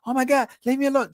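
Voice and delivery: high, fast imitation of a screaming woman; mock scream. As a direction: rattle off a mock panicked plea. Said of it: "she will scream".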